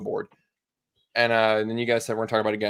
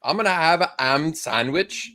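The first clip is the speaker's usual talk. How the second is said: put-on dropped-H accent; 6 semitones higher than usual. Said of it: "Just drop the H".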